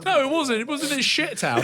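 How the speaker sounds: high, laughing protest. Real, not acted. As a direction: laugh through the correction. Teasing host laughing at correction.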